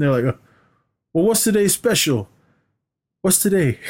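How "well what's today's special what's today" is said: high mock quiz. Acted cop quiz.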